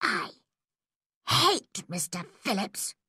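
quiet, seething schoolgirl; hushed resentment; hateful private thought. A narrator's reading. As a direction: think it aloud in hushed, intense anger. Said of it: "she thought".

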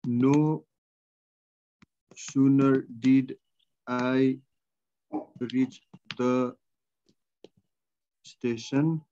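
very slow, long pauses between words; word-by-word dictation. Real, not acted. Teacher giving students the transformed sentence, word-by-word dictation. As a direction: dictate it piece by piece for students writing.

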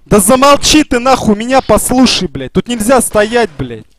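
loud angry shouting; raging gamer. Real, not acted. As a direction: shout him down, furious. Shouting down a teammate. (гневный крик)

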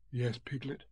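soft Pooh voice; hushed reply. A narrator's reading. Pooh answers whispering Piglet, hushed. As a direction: answer softly.

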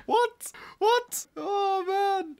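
very high what, what; stunned high-pitched what; shocked disbelief. Real, not acted. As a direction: squeak out the shock. Stunned reaction.

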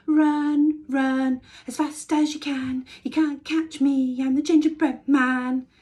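high, loud teasing chant; shouting taunt. A narrator's reading. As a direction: shout the taunt over your shoulder. Gingerbread man taunting again.

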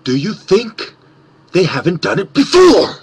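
the trademark question, leaned into hard; signature catchphrase. Shown as a demonstration. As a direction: deliver the pet line with swagger. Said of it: "why I always say".

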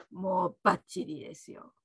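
ordinary talk

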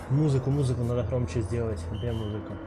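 ordinary delivery

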